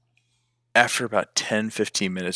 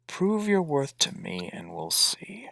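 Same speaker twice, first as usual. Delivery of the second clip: slow, whispery, higher creature voice; hissing totem; 6 semitones higher, 3 dB quieter, slower than usual. Acted. Totem challenging the orc to prove worth, hissing whisper. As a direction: breathe out the challenge slowly, like a spirit in the head. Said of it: "hissing kind of a chuckle in your head".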